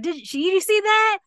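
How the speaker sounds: high, loud triumphant call; hollering out. Retold or imitated. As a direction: imitate a shout across to a trainer. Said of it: "she hollers out at the guy".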